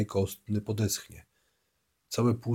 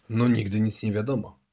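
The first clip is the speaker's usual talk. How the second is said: ordinary delivery, nothing marked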